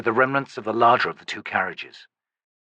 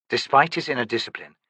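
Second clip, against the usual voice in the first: usual speaking voice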